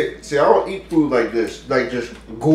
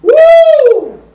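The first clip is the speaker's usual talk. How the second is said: high, loud whoop; excited whoop; 11 dB louder than usual. Real, not acted. Reacting to the spicy sauce.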